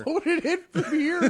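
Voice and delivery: high, laughing question; laughing disbelief. Real, not acted. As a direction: repeat it in amused disbelief. Incredulous laughing repeat.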